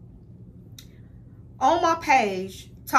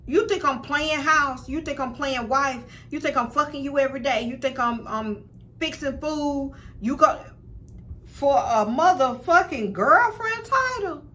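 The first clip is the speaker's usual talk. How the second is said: indignant rant; 5 semitones higher than usual. Retold or imitated. Woman retelling confronting her ex about marriage, raised indignant rant. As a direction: demand answers, furious and insulted. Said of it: "And I'm like".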